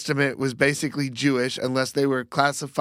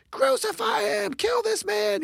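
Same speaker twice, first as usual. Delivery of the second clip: mock crowd scream. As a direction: imitate a screaming mob in a strained high voice, held back in volume. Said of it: "for them to be screaming".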